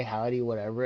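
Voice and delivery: ordinary delivery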